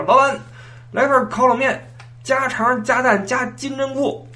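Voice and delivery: high, drawn-out shout to the vendor; loud customer call-out. Acted. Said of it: "喊这么大声". (高声拖长地喊着点单)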